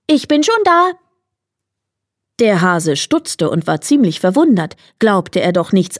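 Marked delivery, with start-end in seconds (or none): 0.1-0.9 wife calling. A narrator's reading. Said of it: "rief ihm die Frau des Igels entgegen".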